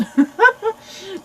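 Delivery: high, loud laugh; self-conscious laugh. Real, not acted. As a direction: laugh at your own bad pronunciation. Laughs at own attempt at Russian.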